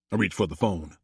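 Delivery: ordinary delivery, nothing marked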